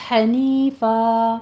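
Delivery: حنیفہ ending in a long alif held two counts; long final a. Shown as a demonstration. Teacher shows students the pausal ending of حنیفہ. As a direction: stretch the end of حنیفہ slowly. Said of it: "فتحتین کو to count کرنے ہیں".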